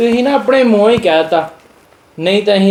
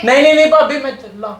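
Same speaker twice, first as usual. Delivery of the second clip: high, hurried mock alarm; mock panic; 6 semitones higher than usual. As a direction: back off in pretend fright, higher. Threatened with shoes, backs off.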